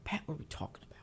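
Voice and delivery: whispered mock of Brian asking; whispered imitation. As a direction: breathe it like a sheepish co-host. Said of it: "It's always".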